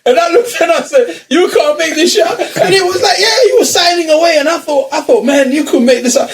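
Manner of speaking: high-pitched, cracking up while telling the story; laughing disbelief. Real, not acted. Friend telling mates about the signing in front of the boyfriend, laughing in disbelief. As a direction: tell it through laughter, voice riding way up.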